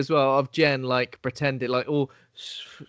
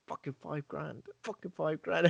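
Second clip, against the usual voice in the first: muttering to himself; 10 dB quieter than usual. Retold or imitated. Grieving father muttering about lost cash, soft bitter grumble. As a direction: grumble it under your breath over the lost money. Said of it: "I'd still be going".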